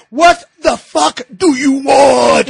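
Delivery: loud, high, drawn-out mock scream; retold scream. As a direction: act out screaming back at a startling stranger. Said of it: "I'm just screaming at this listener".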